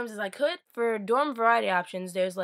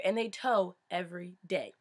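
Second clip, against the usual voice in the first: ordinary delivery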